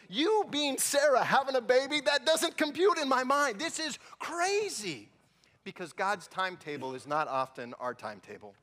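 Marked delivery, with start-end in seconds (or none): 0.1-5.1 higher and louder, wide-eyed wonder; animated astonishment; amazed disbelief. Real, not acted. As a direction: stress how crazy it is. Preacher marvels at Sarah's age.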